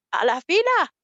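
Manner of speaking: high, loud snap; mock order. Preacher voicing a scolded line-cutter, short loud order. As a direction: bark the order to get in line. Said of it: "Como muy".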